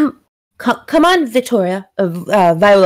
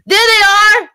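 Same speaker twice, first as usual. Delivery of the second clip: booming shout, much higher and louder; magically amplified voice; 12 semitones higher, 5 dB louder than usual. Acted. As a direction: call out across the square. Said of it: "you hear a loud voice".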